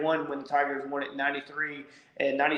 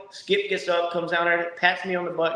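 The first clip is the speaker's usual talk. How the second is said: ordinary talk, nothing marked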